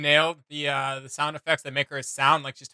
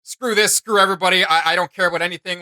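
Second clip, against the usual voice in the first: loud mock rage, pitched up; furious outburst; 4 semitones higher, 9 dB louder than usual. Fan acting out a villain's breaking point, loud and raging. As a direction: yell it like a hurt villain snapping.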